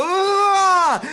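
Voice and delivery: loud high yell of pain. Retold or imitated. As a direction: yelp as if just bitten. Bitten on the chin, yelling.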